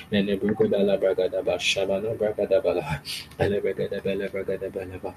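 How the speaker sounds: rapid flowing tongues prayer; speaking in tongues. Acted. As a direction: let the syllables pour out. Praying in tongues.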